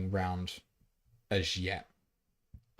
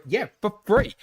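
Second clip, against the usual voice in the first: much higher, louder, delighted mock pitch; mock excited confirm; 12 semitones higher, 7 dB louder than usual. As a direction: sell it like an overjoyed host.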